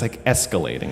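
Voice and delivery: ordinary talk, nothing marked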